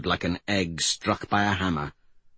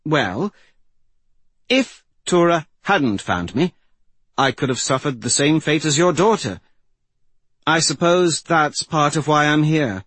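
higher-pitched voice for the mystic; a hint of character voice, higher than the narration; lightly voiced young mystic; 6 semitones higher than usual. A narrator's reading. Mystic confiding in the old woman, lightly voiced, higher.

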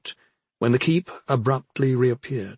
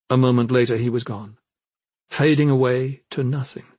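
usual speaking voice

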